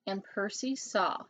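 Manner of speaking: ordinary delivery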